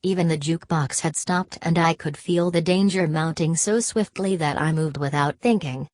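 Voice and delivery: ordinary delivery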